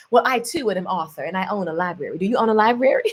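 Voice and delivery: imitating the famous narrator voice for a joke; Norman Freeman voice. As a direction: drop into a hushed narrator voice to tease a friend. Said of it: "And he was like".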